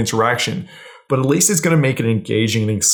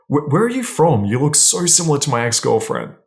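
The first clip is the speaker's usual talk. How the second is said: curious, slightly judging; 3 semitones higher than usual. Shown as a demonstration. Man sizing up a girl he just met, intrigued and curious. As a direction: ask as if suddenly puzzled by them. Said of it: "You need to add curiosity to it or judgment".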